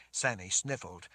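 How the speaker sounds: ordinary delivery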